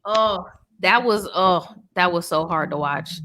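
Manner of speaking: ordinary delivery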